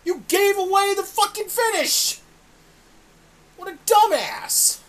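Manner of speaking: yelling at Jericho through the screen, outraged; furious shout. Retold or imitated. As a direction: scream at the TV like he ruined the show. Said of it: "and I'm like".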